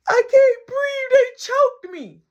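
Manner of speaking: loud, high, slow crying wail; hammed-up tearful cry; mock bawling. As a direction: wail the accusation like a sobbing child. Boy wails accusation to nana.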